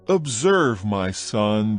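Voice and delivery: usual speaking voice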